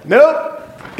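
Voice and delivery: high, rising verdict; unbothered colleague. Retold or imitated. Colleague after sniffing the breath, high-pitched denial. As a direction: pop it up high, like announcing a test result. Colleague reports smelling nothing.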